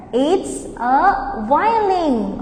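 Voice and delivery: slow, clear answer for repeating; slow model answer. Shown as a demonstration. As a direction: say it slowly for learners. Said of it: "And answer".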